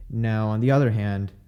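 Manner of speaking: ordinary delivery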